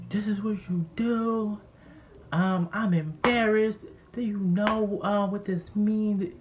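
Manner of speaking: high, slow, outraged mock voice; imitated scolding dad. Retold dad berating his son.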